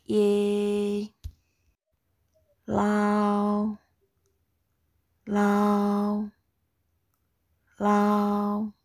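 "Ye" and "Lao" drawn out on one even pitch; high level tone. Shown as a demonstration. Teacher drills class to repeat, high level tone. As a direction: hold every "Ye" and "Lao" steady. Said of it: "The first tone is very high level".

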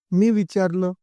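usual speaking voice

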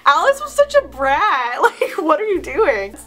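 drawn-out exasperation at a brat; exasperated. Real, not acted. Exasperated at younger self's story.

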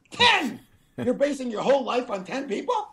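laughing, high squeal of incredulity; ridiculing with an amazed, high-pitched laugh; mock disbelief. Mock disbelief at only ten names.

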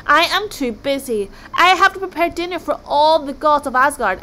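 loud, high-pitched exasperation; exasperated refusal. A narrator's reading. Overworked cook of the gods, loudly exasperated. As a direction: complain forcefully, pressing each word. Busy god refuses his visitors.

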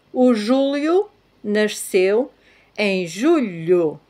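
slow European Portuguese, "Julio" with l-i, "julho" with lh; Portuguese sentence demo. Shown as a demonstration. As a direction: stretch each word to contrast "Julio" and "julho". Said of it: "Después la frase".

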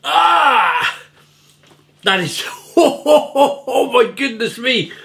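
high, loud gasps at a sour sip; puckering exclamations, shocked by sourness; sour drink reaction. Real, not acted. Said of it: "that's sour".

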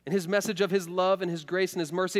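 ordinary talk, nothing marked

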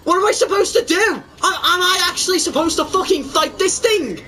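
high, wailing protest; panicked disbelief. Real, not acted. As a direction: plead in alarm at having to fight it. Panics at having to fight it.

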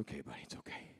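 whispered, gentle comfort; soft reassurance. Retold or imitated. Father whispering comfort to his scared son, softly. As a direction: hush a scared child.

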